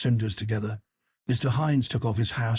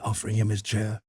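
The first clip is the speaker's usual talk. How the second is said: ordinary delivery, nothing marked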